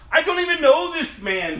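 loud, high-pitched denial; vehement oath. Acted. Peter denying again to bystanders with an oath, vehement. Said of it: "this time with an oath".

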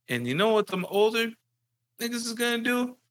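high mock voice of a taunting father; mocking dad. Dad scaring his son about older kids, high mock voice. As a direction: sneer out the threat. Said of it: "he's like".

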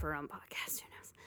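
unvoiced whispered aside. Real, not acted. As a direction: whisper it as a secret.

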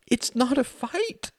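very high, slow, breaking protest. A narrator's reading. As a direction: let the voice crack upward in hurt. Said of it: "her voice breaking again".

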